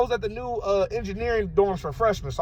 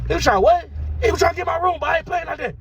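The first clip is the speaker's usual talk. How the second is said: indignant; 7 semitones higher, faster than usual. Retold or imitated. Friends reacting to a stranger trying the room, indignant. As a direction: raise the pitch in disbelieving protest. Retold indignant exchange about the intruder.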